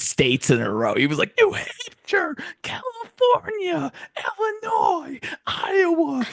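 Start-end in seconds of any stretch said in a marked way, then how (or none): 1.4-6.2 very high, strained imitation of an anime hero; mock battle cry. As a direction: call out each name like an attack. Imitating Deku shouting state attacks.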